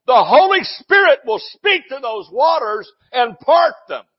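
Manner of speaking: full-force shouted sermon; screaming the message. Real, not acted. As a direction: scream it at the waters with authority. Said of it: "He's screaming the message".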